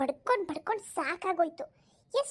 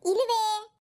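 ordinary delivery

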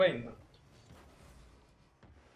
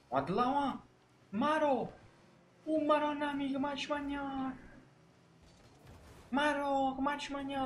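excited high commentary; 11 semitones higher than usual. Pretend.

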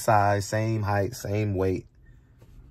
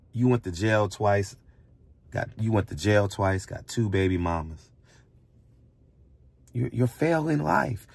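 ordinary talk

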